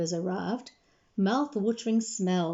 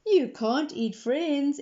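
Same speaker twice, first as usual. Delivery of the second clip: slow, higher sing-song echo; echoing ladies; 6 semitones higher, slower than usual. Acted. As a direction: gently echo the girl's words. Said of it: "echoed the ladies".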